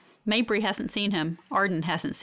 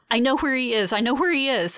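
urgent, louder, higher-pitched realization; breathless; 5 semitones higher, 5 dB louder than usual. A narrator's reading. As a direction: blurt it out twice in breathless haste. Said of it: "I say breathlessly".

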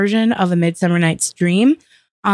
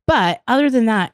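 ordinary talk

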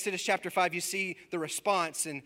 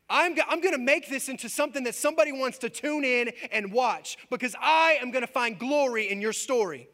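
louder, higher preaching build; fiery preaching; 7 semitones higher, 5 dB louder than usual. Acted. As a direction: preach it with rising fire. Preacher building to full force.

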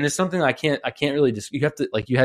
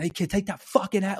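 New York yell; 9 semitones higher, 3 dB quieter than usual. Retold or imitated. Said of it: "like, screaming".

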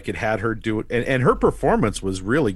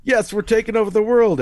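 high, gleeful world-conquest boast; hammed-up triumphant announcement; mock villain triumph; 11 semitones higher, 4 dB louder than usual. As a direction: crow it like a cartoon villain.

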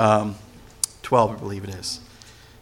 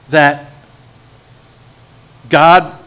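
ordinary talk, nothing marked

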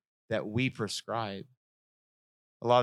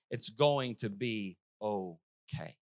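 slow, softer reassurance; God's calm promise; 5 semitones lower, 7 dB quieter, slower than usual. Retold or imitated. Preacher repeating God's words to the congregation, softly and slowly. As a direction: say it gently and slowly. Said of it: "God just said".

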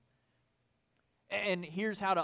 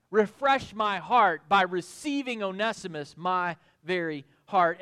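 fervent preaching plea; 4 semitones higher, 7 dB louder than usual. Acted. Paul begging Philemon to welcome Onesimus, fervent. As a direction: lean on each plea.

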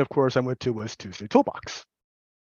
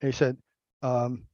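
ordinary talk, nothing marked

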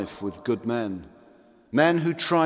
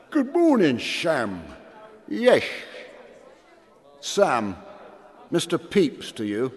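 put-on drunk; 3 semitones higher than usual.

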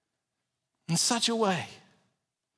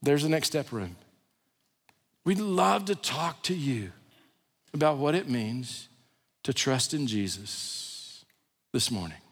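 ordinary talk, nothing marked

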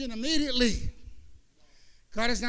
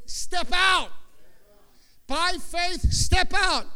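shouted command; 6 semitones higher, 6 dB louder, much slower than usual. Acted. Preacher shouting God's command to step out. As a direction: step out, shouted up high and loud.

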